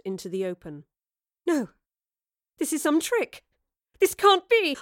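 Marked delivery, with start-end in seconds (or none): 1.4-4.8 high voice climbing in panic; rising terror. A narrator's reading. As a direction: build from disbelief to a terrified cry. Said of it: "Jane's voice rose in terror".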